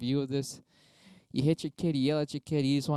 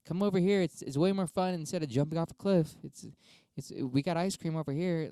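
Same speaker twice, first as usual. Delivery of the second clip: higher, sweet coaxing call; 4 semitones higher than usual. Retold or imitated. Parent coaxing kid away from the cliff, higher coaxing call. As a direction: lure the kid back from the edge. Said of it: "coax them down".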